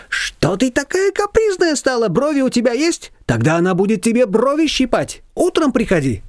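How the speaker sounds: high, lively neighbour voice; Shushana's voice. A narrator's reading. Neighbour brushing off Nina, high and bossy.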